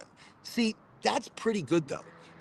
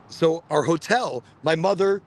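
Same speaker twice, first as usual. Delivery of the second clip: ordinary delivery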